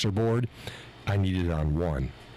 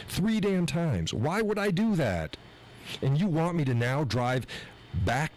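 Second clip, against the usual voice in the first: higher, incredulous outburst about the runaround; exasperated rant; 7 semitones higher than usual. Real, not acted. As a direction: let the outrage spill out, climbing high. Venting fury at being sent back.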